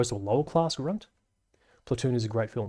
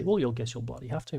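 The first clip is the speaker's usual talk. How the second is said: ordinary talk, nothing marked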